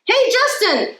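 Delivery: high call across the court. A narrator's reading. Emilio calling Justin from the court, high call. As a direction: call out to a friend. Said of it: "Emilio calls".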